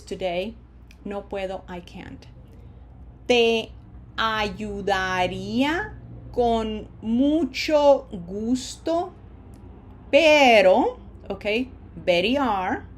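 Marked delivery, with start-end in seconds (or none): 3.3-11.0 slow Spanish, drawn out word by word; slow model sentence. Shown as a demonstration. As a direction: say it slowly and clearly for learners. Teacher models a sentence for repetition.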